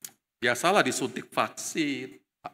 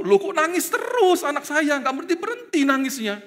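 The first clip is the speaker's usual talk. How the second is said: annoyed and worried; 12 semitones higher, 4 dB louder than usual. Retold or imitated. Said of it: "kesel juga saya. Dan khawatir juga".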